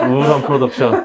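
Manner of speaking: cracking up as you name it; laughing. Real, not acted. Joking with the cast about the gloomy production, laughing.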